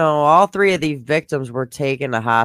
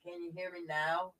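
muffled mic, far softer; 3 semitones higher, 20 dB quieter than usual. Real, not acted. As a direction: voice dulled by a bad microphone. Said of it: "something's going on".

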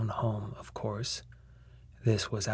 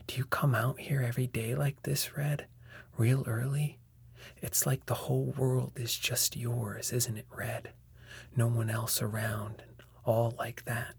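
usual speaking voice

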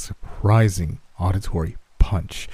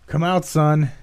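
high-pitched yell from dad; raised, commanding yell; yelled call; 8 semitones higher, slower than usual. A narrator's reading. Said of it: "my dad yelled".

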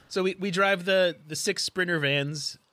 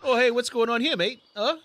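lightly voiced nosy neighbor, higher pitched; 8 semitones higher than usual. Player jokes as the agent posing as nosy neighbor. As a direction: pipe up like a mock nosy neighbor. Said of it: "like, a nosy neighbor".

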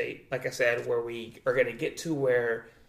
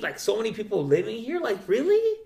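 climbing higher into a loud incredulous really; shocked disbelief; 6 semitones higher, 3 dB louder than usual. Retold or imitated. Seeing the poor family's crowded apartment, rising disbelief. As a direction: rise into an amazed really. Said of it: "I'm like".

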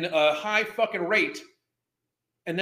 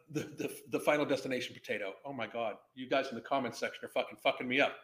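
suppressed laughing mutter; 8 semitones lower, 10 dB quieter, faster than usual. Real, not acted. Reading funny comments, cracking up.

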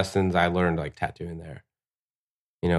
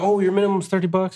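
high mock customer; 12 semitones higher than usual. Customer asking the price.

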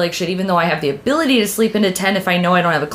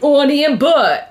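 put-on Boston accent; 6 semitones higher, 3 dB louder, slower than usual. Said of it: "I was born with a Boston accent".

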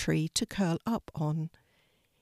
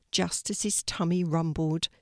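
ordinary delivery

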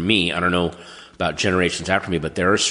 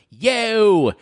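higher, louder fake British "Yo!"; 12 semitones higher, 4 dB louder than usual. Mocking eighties bands saying "Yo!" in fake British accent. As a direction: bark out "Yo!" in a put-on British accent. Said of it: "his impression of all the bands".

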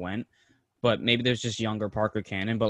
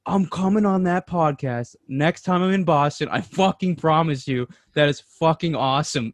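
enthusiastic promise; 5 semitones higher, 5 dB louder than usual. Retold or imitated. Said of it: "And he's like".

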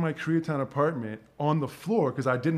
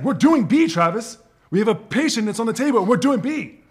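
furious shout; 9 semitones higher, 9 dB louder than usual. Retold or imitated. Retold doctor yelling in OR.

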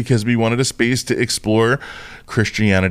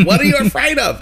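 higher, sing-song Leslie Jordan impression; 12 semitones higher than usual. Retelling his therapist, a higher Leslie Jordan voice. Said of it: "He sounded like Leslie Jordan".

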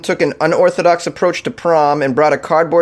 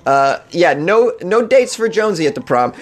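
normal talking voice

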